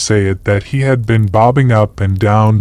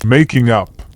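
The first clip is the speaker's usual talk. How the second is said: ordinary delivery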